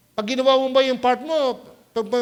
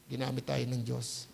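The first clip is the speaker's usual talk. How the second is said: ordinary talk, nothing marked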